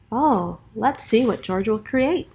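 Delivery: usual speaking voice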